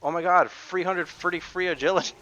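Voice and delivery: loud, high excited outburst with "three" as "free". Retold or imitated. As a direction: exclaim it loudly, saying f for th. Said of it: "I get real excited".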